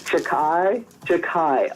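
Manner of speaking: Jachai with a hard K, "juh-KAI", first asking, then settling; correct pronunciation. Shown as a demonstration. Host repeating the player's name after hearing it, pronounced with a K. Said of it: "That's how you say his name".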